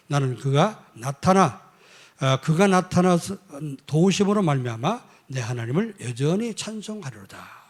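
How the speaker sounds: loud, emphatic psalm reading; preacher declaiming scripture. Acted. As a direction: proclaim the verse, stretching the key words. Reads the psalm verse forcefully. (힘차게 선포하는 성경 낭독)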